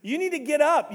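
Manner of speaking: high loud call; loud call to rise; loud urgent refrain. Acted. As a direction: wake them up. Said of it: "repeated refrain".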